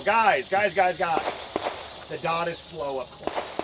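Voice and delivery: hammed-up excited podcast guest; mock podcast plea. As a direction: ham up a shooter begging for attention. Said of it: "go on the Wilson Combat podcast and go".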